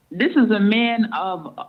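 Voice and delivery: normal talking voice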